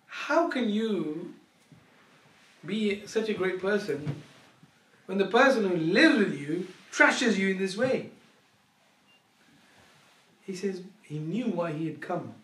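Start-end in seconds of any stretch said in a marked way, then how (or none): 0.1-8.1 baffled challenge. Acted. Visitor challenges the saint, baffled.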